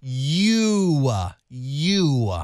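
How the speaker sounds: "You" with a long drawn-out oo; slow, over-mouthed "You"; exaggerated lip shape. Shown as a demonstration. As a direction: stretch the vowel of "You" for a lip-reader. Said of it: "what you're doing with your lips".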